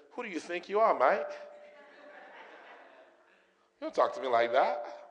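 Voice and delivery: loud Australian comeback. Acted. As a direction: put on an affronted Aussie voice. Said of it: "an Australian would have said".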